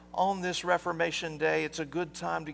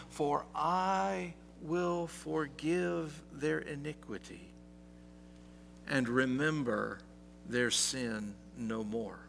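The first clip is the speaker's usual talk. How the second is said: solemn scripture reading; 8 dB quieter, slower than usual. Acted.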